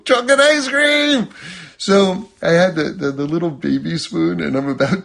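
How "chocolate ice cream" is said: much higher and louder, thrilled; excited exclamation. Retold or imitated. Parent hyping chocolate ice cream to a baby, thrilled. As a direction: announce the treat like a party.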